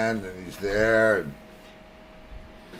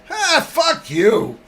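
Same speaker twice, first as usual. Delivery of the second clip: parrot cursing; 13 semitones higher, 5 dB louder, much slower than usual. Acted.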